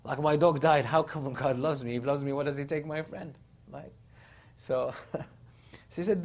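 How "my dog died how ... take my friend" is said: loud retold protest, imitating an Australian; imitated Australian indignation, easing off toward the end; Australian accent. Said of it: "his own Australian accent".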